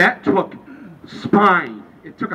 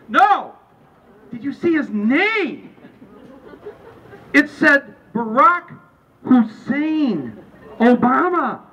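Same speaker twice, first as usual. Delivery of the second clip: high, louder, drawn-out disbelief; incredulous insistence; 7 semitones higher, 4 dB louder, much slower than usual. Acted. Insisting on the name on the ballot, high and incredulous. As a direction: hammer out each name, pitched up and incredulous. Acted exchange escalating to disbelief.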